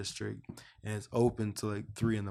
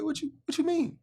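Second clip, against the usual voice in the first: much higher, incredulous question; can't believe what he just heard; stunned disbelief; 5 dB louder than usual. Retold or imitated. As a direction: broke man stunned by an offer of pay. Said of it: "I'm like".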